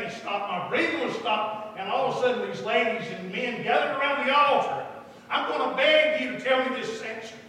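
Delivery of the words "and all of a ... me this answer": raised, emphatic preacher's cadence; fervent preaching. Acted. Preacher challenging the congregation about answered prayer, fervent preaching. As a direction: press each question on the crowd with fervor.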